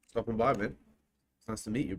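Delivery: normal talking voice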